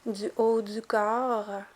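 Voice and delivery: ordinary talk, nothing marked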